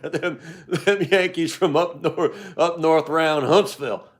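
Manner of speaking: lightly voiced Southern twang with a laugh; hammed-up Southern drawl. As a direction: keep the good-ol'-boy voice going while cracking up. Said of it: "like a nascar voice".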